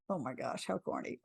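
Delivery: whispered self-conscious aside; embarrassed whisper. Real, not acted. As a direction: half-whisper it to yourself. Embarrassed aside about her metaphor.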